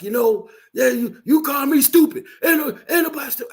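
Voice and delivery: angry man quote. Retold or imitated. Retelling the angry man's outburst at the game, heated and fast. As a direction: play the angry guy behind him, fast and heated. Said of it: "Mister Angry Man, is like".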